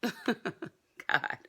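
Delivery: soft laughing disbelief; overwhelmed laugh. Real, not acted. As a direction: breathe out an amazed laugh. Overwhelmed by total weight lost.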